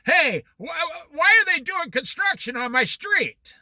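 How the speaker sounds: mock complaint. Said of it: "you're screaming".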